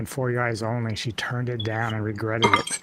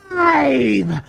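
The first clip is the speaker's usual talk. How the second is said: much higher, much louder bellow; a huge theatrical battle cry; booming roar; 13 semitones higher, 9 dB louder than usual. Pretend. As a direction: roar it like a hammy film hero. Imitating the actor's famous roar.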